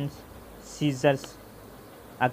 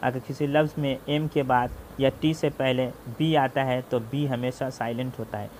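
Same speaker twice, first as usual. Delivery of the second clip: normal talking voice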